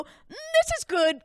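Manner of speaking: high, slow polite tasting voice; fake polite praise. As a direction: pretend you like the dog treat. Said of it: "we go".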